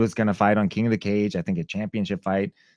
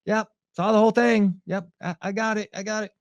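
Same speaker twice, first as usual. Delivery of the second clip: placating; 12 semitones higher than usual. Retold or imitated. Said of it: "I was like".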